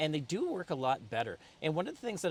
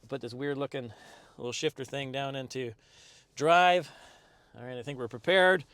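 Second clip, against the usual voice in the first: ordinary talk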